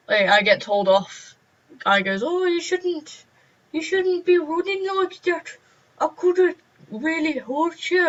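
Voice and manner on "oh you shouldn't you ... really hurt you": put-on high, slow old man voice; old man teacher. Old teacher scolding a running pupil, a high creaky voice. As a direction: quaver it like an elderly teacher. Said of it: "he was old".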